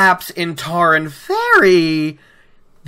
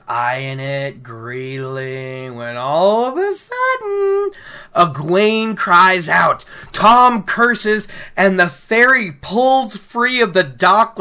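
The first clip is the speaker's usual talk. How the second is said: dramatic storytelling; 4 semitones higher, slower than usual. Acted. Dramatic retelling of whirlpool.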